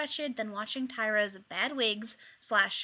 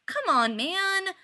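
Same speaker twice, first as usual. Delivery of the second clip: pleading; 8 semitones higher, 7 dB louder, slower than usual. A narrator's reading. Chad pleads after refusal.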